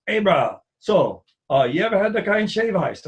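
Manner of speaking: put-on friendly local kid talking Pidgin; light Hawaiian Pidgin imitation. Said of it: "he's just super pigeon".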